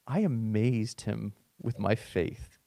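a hint of the centurion savoring it; centurion's hushed boast, slowed down; quiet mock brag. Centurion voiced bragging quietly.

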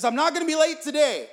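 high, loud determined outburst; frantic grandson. Acted. Frantic grandson telling his grandfather he'll be early, insistent.

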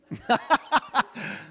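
real high laugh; laughing as he admits it himself. Real, not acted. Laughing at own joke.

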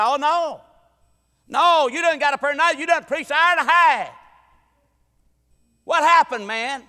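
high, loud protesting shout; comic indignant yell; mock outrage. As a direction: cut him off in hammed-up disbelief. Acted church protest at preacher.

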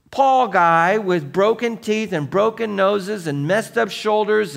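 loud, driving preacher's voice; preaching at full force. Acted. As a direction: hammer the point home to the church. Sermon builds to full preaching.

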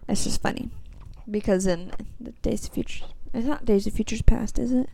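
hushed aside; whispering. Real, not acted. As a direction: whisper it under your breath. Quiet aside.

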